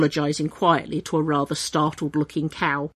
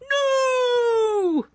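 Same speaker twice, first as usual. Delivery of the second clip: high dramatic cry of "No!"; a cry flung out mid-leap. Acted. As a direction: hurl a heroic shout. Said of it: "with a dramatic".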